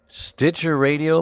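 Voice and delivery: usual speaking voice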